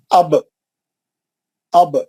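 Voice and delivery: اب said as "ab" with zabar, the alif and ba joined; reading aloud slowly. Shown as a demonstration. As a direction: read اب as "ab", short a then ba held still. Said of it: "اب کیسا پڑھیں گے اس؟". (اب کو زبر کے ساتھ "اَب" پڑھنا)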